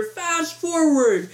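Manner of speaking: high, drawn-out mock yell without much volume; imitated yelling. Audience yelling at the projectionist, a held-back yell. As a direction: stretch each word like calling across a theater. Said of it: "we're literally yelling in the theater".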